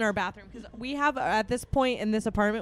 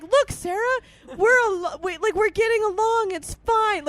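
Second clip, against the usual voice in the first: high, loud, over-eager retold reassurance; placating; 9 semitones higher, 6 dB louder than usual. Two rivals calming a crying friend, imitated placating. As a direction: placate a crying drunk, pitched up and insistent.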